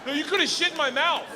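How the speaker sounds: hammed-up complaint to the wife; mock outrage. Ranting at wife, high mock outrage.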